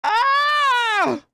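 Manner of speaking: frustrated shout. Real, not acted.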